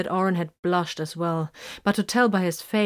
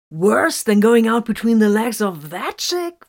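crude soldier jeer; 5 semitones higher, 9 dB louder than usual. A narrator's reading. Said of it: "Varn asked".

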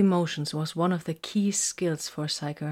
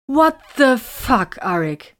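high, loud, drawn-out disbelief; slow, raised-voice shock, easing on the name; taken-aback exclamation; 7 semitones higher, 8 dB louder, slower than usual. A narrator's reading. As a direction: exclaim in appalled astonishment. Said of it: "Vox exclaimed, completely taken aback".

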